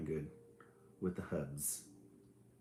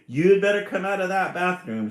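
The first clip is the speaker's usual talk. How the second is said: a loud, much higher yell through the door; shouted; 10 semitones higher, 11 dB louder than usual. Acted. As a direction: pound the door and yell at her. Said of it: "shouted brother".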